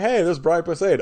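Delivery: high, loud, delighted spotting of someone; mock excited recognition. Fan retelling spotting an actor on screen, mock excited. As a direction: call it out like greeting a surprise guest.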